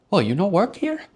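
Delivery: high, loud mock of the disbelieving guy. The guy doubting him at the door, hammed-up high voice. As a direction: ham up his surprise. Mocked guy's retort.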